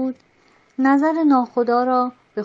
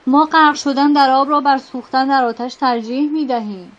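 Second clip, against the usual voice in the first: louder defiant cry; shouting; 6 dB louder than usual. Acted. A sailor shouting his choice to drown, loud cry. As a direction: yell it over the crew. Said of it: "ملوان دیگری فریاد زد".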